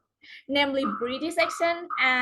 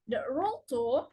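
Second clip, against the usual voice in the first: ordinary talk, nothing marked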